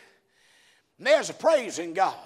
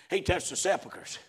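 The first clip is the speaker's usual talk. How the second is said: ordinary delivery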